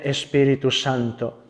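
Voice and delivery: ordinary delivery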